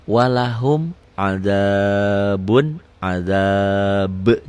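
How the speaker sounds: slow drawn-out Quranic recitation of "Walahum adabun adab"; recitation demo. Shown as a demonstration. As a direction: chant "Walahum adabun adab" slowly, every sound clear. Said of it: "Idhar itu tulisan dan bacaannya sama".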